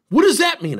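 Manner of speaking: high, loud puzzled challenge; baffled retort. Retold or imitated. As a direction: fire the question back in disbelief. Confused worker retold.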